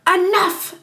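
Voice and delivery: high, sharp exclamation of command; mock intimidating. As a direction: imitate a woman cutting everyone off. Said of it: "her voice kinda sounds intimidating".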